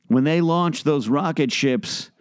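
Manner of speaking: ordinary talk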